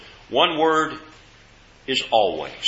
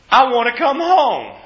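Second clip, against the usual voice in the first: higher, louder tearful plea; tearful daughter; 9 semitones higher, 4 dB louder than usual. Retold or imitated. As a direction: plead like a crying daughter wanting home. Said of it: "And she says".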